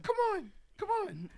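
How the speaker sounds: high, light urging; high coaxing voice; Mike impression. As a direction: beckon them along brightly. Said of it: "He's like".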